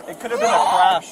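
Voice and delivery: normal talking voice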